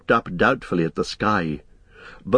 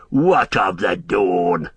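slow sneering orc jeer; mild orc jeer; 3 semitones lower, slower than usual. A narrator's reading. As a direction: scoff at the dawn like an orc. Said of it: "they jeered".

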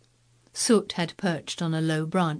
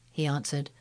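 ordinary delivery, nothing marked

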